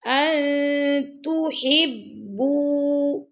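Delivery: very slow melodic chant; Quran recitation. Shown as a demonstration. Teacher recites for pupils, slow melodic chant. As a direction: stretch the vowels in recitation. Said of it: "teacher demonstrates recitation".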